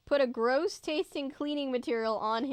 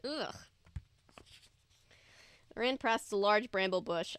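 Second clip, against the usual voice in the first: ordinary delivery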